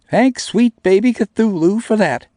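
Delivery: put-on high, loud and slow sarcastic girl. A narrator's reading. Namie sarcastically thanking him, high, loud and slow.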